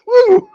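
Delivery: high, loud whoop; loud high joking whoop; mock whoop. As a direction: whoop like a cheering fan. Mock cheer after quote.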